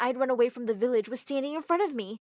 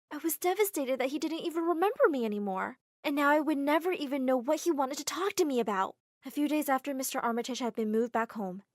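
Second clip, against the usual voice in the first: ordinary delivery, nothing marked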